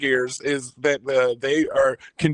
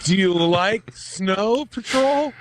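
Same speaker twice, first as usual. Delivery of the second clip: slow, careful try at a Scottish accent, pitched up; Scottish accent, slowly; 7 semitones higher, much slower than usual. Shown as a demonstration. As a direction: sound out the Scottish line slowly. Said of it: "Wait, say it slowly".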